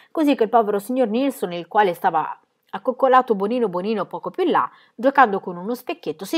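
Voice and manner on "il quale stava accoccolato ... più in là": plain reading voice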